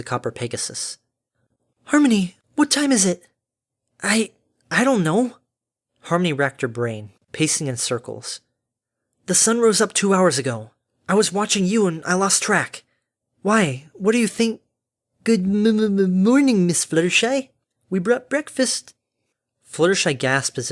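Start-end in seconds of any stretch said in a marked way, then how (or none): 1.9-3.3 high, louder, urgent question; alarmed. Acted. Fluttershy suddenly asks Harmony the time, alarmed. 4.0-14.6 higher voice, stumbling at the start; flustered Harmony. Acted. Harmony admits she lost track of time, flustered. 15.2-18.9 high, slow, stuttering chirp. A narrator's reading. Derpy calls a greeting from outside, chirping. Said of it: "where the chirping voice had emanated from".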